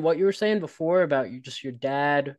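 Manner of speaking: normal talking voice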